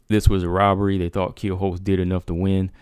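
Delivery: normal talking voice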